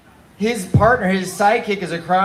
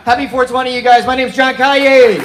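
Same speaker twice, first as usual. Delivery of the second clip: loud, higher, slowed-down sign-off; 7 semitones higher, 9 dB louder than usual. Real, not acted. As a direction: shout your name to the room. Comedian signing off loudly.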